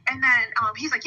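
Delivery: ordinary delivery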